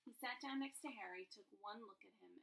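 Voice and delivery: ordinary delivery, nothing marked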